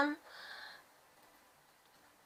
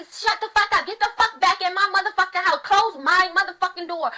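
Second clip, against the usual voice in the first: higher, louder angry mom barking orders; yelling mother; 5 semitones higher, 3 dB louder than usual. Retold or imitated. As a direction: mimic a mother screaming at her kid. Voicing an abusive mother.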